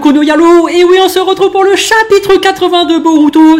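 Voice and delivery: loud, high, hyped-up channel greeting; pumped-up intro yell; hype intro. Real, not acted. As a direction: shout the intro like a show opener.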